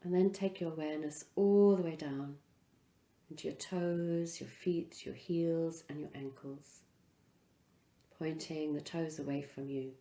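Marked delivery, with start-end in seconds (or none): none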